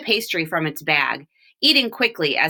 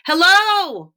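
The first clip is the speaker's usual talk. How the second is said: high loud hello down from the tree; called out; 11 semitones higher, 7 dB louder than usual. Acted. Walter calling to the men below, loud call. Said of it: "Walter called or yelled out".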